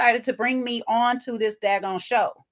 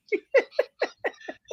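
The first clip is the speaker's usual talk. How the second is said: high laugh; 12 semitones higher, 3 dB quieter than usual. Real, not acted. Laugh after point.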